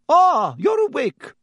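high, loud brisk greeting. A narrator's reading. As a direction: greet the waking patient briskly. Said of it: "she said briskly".

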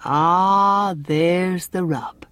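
slow, drawn-out regret; wistful, lingering resignation; sighing. A narrator's reading. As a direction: let the words out in a long sigh. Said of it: "sighed Anne".

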